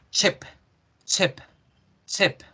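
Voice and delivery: "chip" meaning ship, vowel cut short and dry; "chip" with a clipped lax vowel. Shown as a demonstration. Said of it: "debo hacer la I más".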